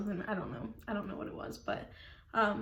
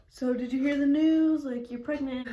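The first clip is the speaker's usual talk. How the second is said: cheery doctor; 5 semitones higher, 8 dB louder than usual. Retold or imitated. Doctor voiced announcing the news.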